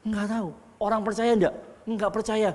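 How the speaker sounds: ordinary talk, nothing marked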